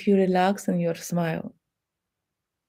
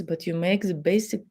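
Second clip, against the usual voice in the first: ordinary talk, nothing marked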